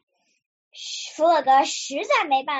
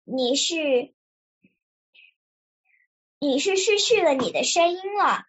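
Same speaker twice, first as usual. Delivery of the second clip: measured storybook pronouncement, pausing before the verdict; mildly grave, with a long suspenseful pause; serious. A narrator's reading. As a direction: deliver the diagnosis solemnly. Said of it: "严肃地说".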